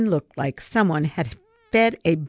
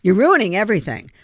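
louder, fast scolding; fast annoyed rebuke, louder; irritated snap; 4 semitones higher, 7 dB louder, faster than usual. A narrator's reading. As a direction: scold the boy for spoiling it. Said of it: "Savannah glared at him".

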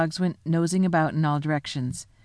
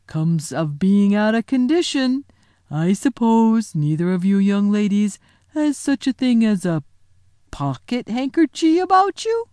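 a big clumsy gent asking a favour; giant's yokel voice; 2 semitones higher, 4 dB louder than usual. Acted. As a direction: giant politely asks the girls for a hanky. Giant asks girls.

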